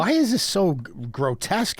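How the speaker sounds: mildly alarmed protest, pitch jumping at the start; sounding terrified. Real, not acted. Startled by crude jokes from co-host, sounding terrified. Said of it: "You sound terrified".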